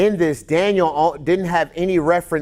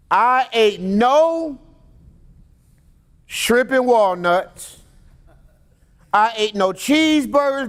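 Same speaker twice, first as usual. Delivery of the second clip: high, slow, drawn-out mock biblical voice; mock Daniel; 8 semitones higher, slower than usual. Preacher imitating Daniel's fasting vow, high and slow. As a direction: stretch each word like a grand prophet, pitched up. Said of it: "Daniel starts off by saying".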